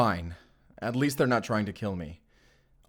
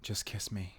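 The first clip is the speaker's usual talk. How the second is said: tender hush; 2 semitones lower, 16 dB quieter, faster than usual. Acted. Intimate whispered lines before kiss.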